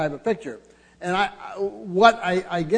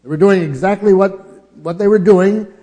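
loud, higher proclamation of scripture; preaching at full force; 5 semitones higher, 10 dB louder than usual. Acted. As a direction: ring out the verse like a warning. Preacher hammers the point.

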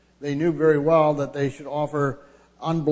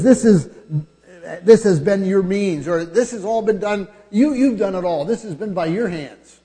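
louder, higher preaching rebuke; 5 semitones higher, 5 dB louder than usual. Acted. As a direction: drive home whose fault it is. Preacher thunders God's rebuke.

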